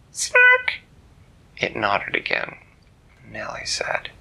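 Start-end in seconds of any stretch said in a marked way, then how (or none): 0.1-0.7 very high, loud creature squeal; snark call. Acted. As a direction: make a sudden piercing little creature cry. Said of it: "the little thing looked at her".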